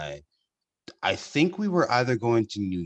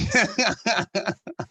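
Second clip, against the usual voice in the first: high burst of real laughter; big laugh; 14 semitones higher than usual. Real, not acted. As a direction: crack up at your own story. Cracking up at own story.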